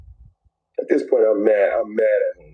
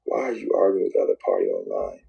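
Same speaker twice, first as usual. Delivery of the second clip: lower, voiceless repeat of the rebuke; low whisper; 10 semitones lower than usual. Retold or imitated. Man whispering the same warning to himself again, lower.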